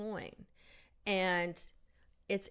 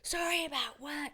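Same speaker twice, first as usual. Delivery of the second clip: angry retort; 8 semitones higher than usual. Retold or imitated. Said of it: "he was very angry".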